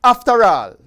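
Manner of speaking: scripture reading. Acted. Host reading Matthew six to his channel viewers, earnest scripture reading. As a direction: read the Gospel aloud like a fervent lay preacher. Reads scripture in Jamaican Patois.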